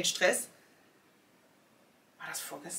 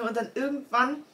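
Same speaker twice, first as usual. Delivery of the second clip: usual speaking voice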